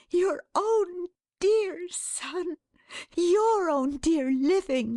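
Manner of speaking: high, slow, exultant cries; ecstatic joy. A narrator's reading. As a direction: savour each phrase in high-pitched rapture. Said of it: "in ecstasy".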